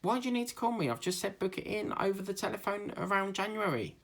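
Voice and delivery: high-pitched indignant retort; exasperated. Retold or imitated. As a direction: protest in a high, put-out voice. Acts exasperated reply to dentist.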